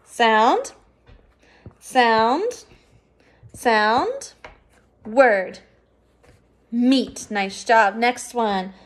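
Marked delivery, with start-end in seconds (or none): 0.0-5.6 each sound drawn out with a rising tune, then the word. Shown as a demonstration. Teacher leading the class sound by sound, rising each time. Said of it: "read these sounds with me".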